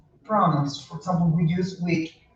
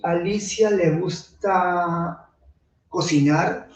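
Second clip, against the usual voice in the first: said in natural Spanish; Spanish sentence. Shown as a demonstration. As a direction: read it as a Spanish speaker would. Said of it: "you want to say".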